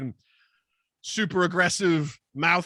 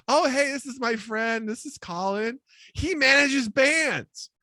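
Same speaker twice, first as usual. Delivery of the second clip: higher, bright breezy intro; perky introduction; 9 semitones higher, slower than usual. Retold or imitated. Woman introducing Colin to narrator, higher and breezy. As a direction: introduce him lightly, too cheerfully. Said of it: "she goes".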